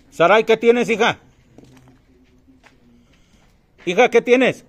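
calling out. Real, not acted. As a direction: shout her name from afar. Calling out to the friend.

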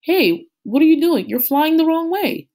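high, loud startled shout; surprised call. A narrator's reading. Sam calling after his falcon, surprised and loud.

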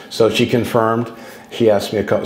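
ordinary delivery

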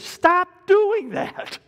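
high, very slow, drawn-out order; stern command. Retold or imitated. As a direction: stretch out each word as a stern warning. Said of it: "He says".

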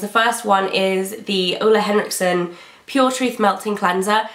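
usual speaking voice

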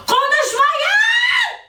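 long high stretched scream; real shriek. Real, not acted. Fed up with viewers' comments, stretched high shriek. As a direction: shriek it, drawn out and desperate. Furious at chat talking about her.

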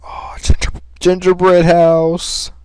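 drawn-out amazed gingerbread house; delighted awe. Real, not acted. Discovers candy house.

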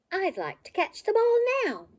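high, loud eager wish. Acted. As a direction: bounce with longing. Petey wishing eagerly.

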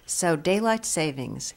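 ordinary talk

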